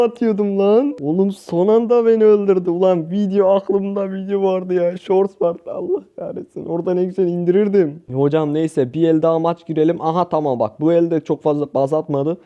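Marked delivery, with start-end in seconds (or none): 5.7-6.7 frustrated squeal. Real, not acted. Losing the game at the last moment.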